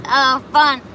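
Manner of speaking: normal talking voice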